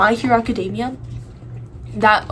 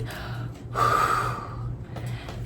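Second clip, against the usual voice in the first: long breath out; 8 dB quieter than usual. Retold or imitated. Acting out calming down from freaking out, long exhale. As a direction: blow out a long steadying breath.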